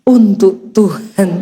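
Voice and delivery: low, slow, measured dedication; forced devotion. Acted. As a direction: hold it in and say it slowly, piously. Forced devotion while stirring husband's coffee.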